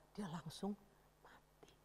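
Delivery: whispered, barely audible; hushed ending. A narrator's reading. Teacher telling of Goliath's death, hushed whisper. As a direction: let the word die away in a whisper. Goliath falls dead, story climax.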